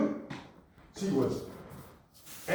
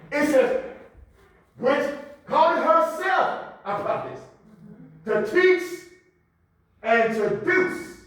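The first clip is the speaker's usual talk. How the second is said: raised sermon voice with pauses between phrases; forceful scripture preaching; 12 semitones higher, 8 dB louder, slower than usual. Acted. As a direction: proclaim the verse, leaning on key words.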